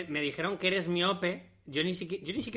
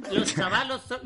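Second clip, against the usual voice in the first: higher, mocking echo of a Nicaraguan accent; imitated Nicaraguan accent; 5 semitones higher, 4 dB louder than usual. Laughing at a friend's phrase on TV, imitated Nicaraguan accent. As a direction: repeat it in a put-on Nicaraguan accent. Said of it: "os habéis reído de su acento".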